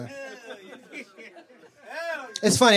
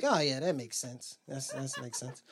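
soft agreeing; 6 semitones lower, 11 dB quieter, slower than usual. Retold or imitated. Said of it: "that you was like".

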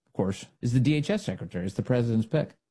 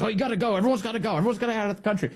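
higher-pitched mock insistence; imitated insistence; 8 semitones higher, much faster than usual. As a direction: rattle off the retold demands, higher. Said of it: "He goes".

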